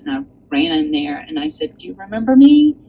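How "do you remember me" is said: higher, louder excited question; running into the store to greet her; eager greeting. Retold or imitated. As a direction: burst in delighted to see her. Rushing in to greet cashier.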